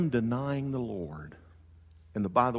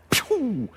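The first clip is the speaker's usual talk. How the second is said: whoosh; 13 semitones higher than usual. Pretend. Sound of John speeding off.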